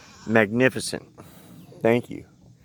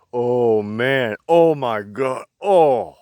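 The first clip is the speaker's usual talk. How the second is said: usual speaking voice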